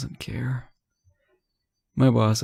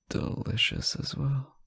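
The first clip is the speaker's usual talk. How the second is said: flirty whisper; 4 semitones higher, 6 dB quieter than usual. Acted. Flirting with partner on phone.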